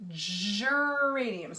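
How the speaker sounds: geraniums said extremely slowly, every sound drawn out; stretched out. Shown as a demonstration. Teacher stretches geraniums so kids hear each sound. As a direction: pull geraniums apart sound by sound. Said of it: "here we go".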